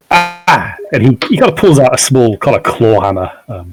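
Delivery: normal talking voice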